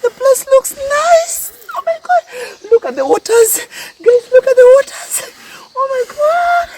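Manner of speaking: high, teary, overwhelmed; emotional. Real, not acted. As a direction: voice rising to near tears. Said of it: "I'm so emotional".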